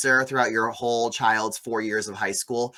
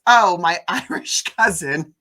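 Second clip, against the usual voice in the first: slow, higher, laughing introduction of a cousin; mock Francesca, amused; 5 semitones higher, 7 dB louder, slower than usual. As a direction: put on her voice, chuckling midway. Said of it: "who she goes".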